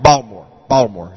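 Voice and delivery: imitating a Baltimore accent, low and clipped vowels; mock Baltimore accent. Preacher mimics friends' Baltimore accent. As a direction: say Baltimore the way locals do. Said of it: "They had that Baltimore accent".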